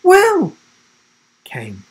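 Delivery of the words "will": loud, very high call; called reply. A narrator's reading. Girl dashing towards the boy, calling out. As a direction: shout the name across a distance.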